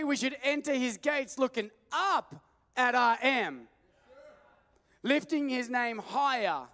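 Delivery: loud, building sermon cadence; preaching. Real, not acted. Preacher builds up the congregation, loud and fervent. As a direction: drive each line home from the pulpit.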